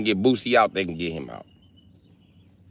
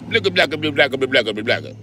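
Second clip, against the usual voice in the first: gun noises; 4 semitones higher, faster than usual. Acted. Imitated gunfire sounds.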